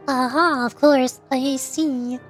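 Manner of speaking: usual speaking voice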